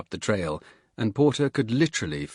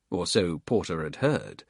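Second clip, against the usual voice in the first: normal talking voice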